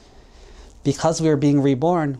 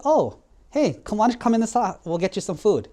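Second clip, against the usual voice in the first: much higher, eager host voice; eager welcome; 7 semitones higher than usual. Acted. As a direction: call him in brightly, excited. Brother welcomes monk eagerly.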